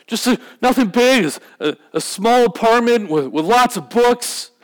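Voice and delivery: high, halting, anguished voice; broken man. Acted. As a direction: act out a broken addict pleading haltingly for a home. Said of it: "the best example of brokenness I've seen".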